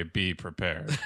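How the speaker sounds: low, quiet villain lion voice; lightly voiced Scar; Jeremy Irons impression. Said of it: "As Jeremy Irons' line would say".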